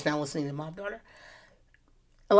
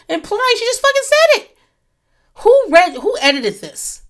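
incredulous; 6 dB louder than usual. Real, not acted. Incredulous at book's wording.

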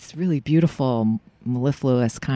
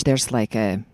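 normal talking voice

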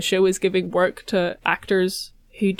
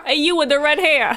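put-on higher, brash Brooklyn accent; 7 semitones higher than usual. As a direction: holler at the redhead in Brooklyn style. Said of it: "They all sound like Joey Wheeler".

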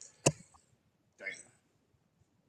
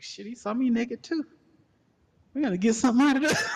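high, louder, fast scheming voice; scheming girlfriend; 13 semitones higher, 7 dB louder than usual. Retold or imitated. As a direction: squeal it like a hustler seeing an angle.

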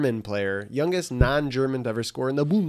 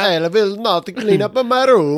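mock Argentine accent; 8 semitones higher, 8 dB louder than usual. Friend joking as Messi in a mock Argentine accent. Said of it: "What's their accent?".

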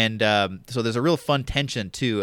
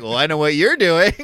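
knowing taunt; 5 semitones higher, 10 dB louder than usual. Acted. Acted opponent spotting hidden tower.

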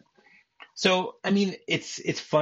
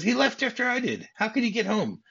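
high-pitched disbelieving retort; mock incredulous protest; 11 semitones higher than usual. Husband disputing his wife's claim, incredulous.

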